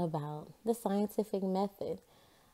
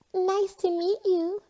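put-on much higher, louder girl's voice; Donna's voice; 13 semitones higher, 5 dB louder than usual. A narrator's reading. Donna greeting Margaret in a high girl's voice. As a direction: greet sweetly in a high girl voice. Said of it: "said Donna".

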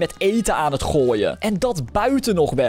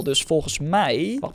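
usual speaking voice

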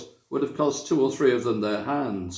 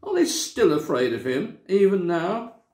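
higher, tearful rising even now; tearful question; 6 semitones higher than usual. A narrator's reading. Susan asking in tearful disbelief. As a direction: ask it through tears in disbelief. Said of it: "sobbed Susan".

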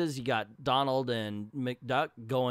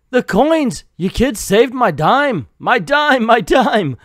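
overjoyed; 10 semitones higher, 15 dB louder, slower than usual. Retold or imitated. Scrooge rescues dime.

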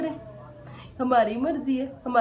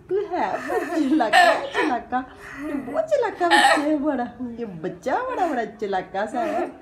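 laughing tease; 3 semitones higher than usual. Real, not acted. Teasing Ahmad while laughing.

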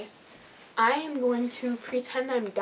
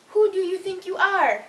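higher, louder mock outrage; loud indignant scolding; 6 semitones higher, 5 dB louder than usual. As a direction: demand it, affronted. Louder repeat scolding the dog.

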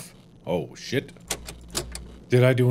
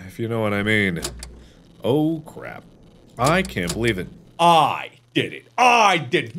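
usual speaking voice

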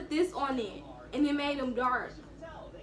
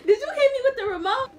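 high, quick, loud and half-laughing; incredulous outburst at being hit; astonished, laughing protest; indignant disbelief; 10 semitones higher, 5 dB louder, faster than usual. Real, not acted. Just hit during the prank.

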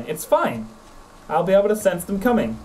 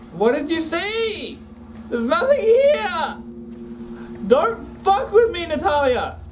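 high, slow and frightened, voice pulled up tight; calling to a partner out of fear, slowly; a pleading frightened squeak; scared high voice; 12 semitones higher, slower than usual. Real, not acted. Scared alone in dark corridor.

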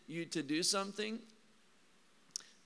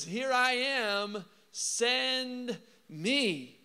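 slow, higher and sincere, laying yourself open; earnest offer; 5 semitones higher, much slower than usual. Retold or imitated. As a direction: offer yourself fully, without holding back. Isaiah answers God willingly.

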